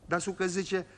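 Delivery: ordinary talk, nothing marked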